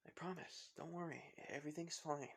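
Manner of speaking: quiet, soothing promise; soft reassurance. Acted. Izuku answering the scared girl, soft reassurance.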